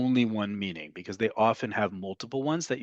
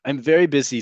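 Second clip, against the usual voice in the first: ordinary talk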